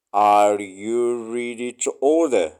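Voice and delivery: ordinary talk, nothing marked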